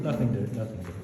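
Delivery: ordinary delivery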